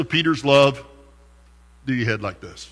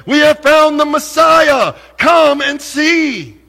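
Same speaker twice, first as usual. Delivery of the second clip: much higher and louder, come and see slowed and shouted; excited announcement; 10 semitones higher, 7 dB louder, slower than usual. Retold or imitated. Philip announces the Messiah to his friend Nathanael, excited shouting. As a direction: burst out with the joyful news. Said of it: "announces to him".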